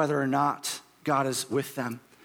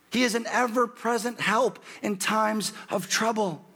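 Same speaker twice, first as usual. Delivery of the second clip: ordinary talk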